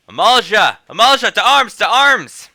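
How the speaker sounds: rally the troops; loud alarm to soldiers; call to arms. Acted.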